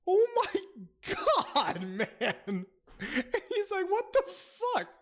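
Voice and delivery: high-pitched, helpless laughter through the words; real laughing fit; laughing disbelief. Real, not acted. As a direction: squeal it while cracking up. Cracking up at Gandalf's insult.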